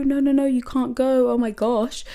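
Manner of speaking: high, desperate mock plea; silent panic. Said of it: "So I'm looking like".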